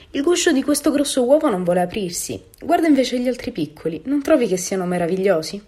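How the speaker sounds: a hint of a fretful mother duck; mother duck, put out. A narrator's reading. Mother duck complaining to a visitor about the egg, mildly put out.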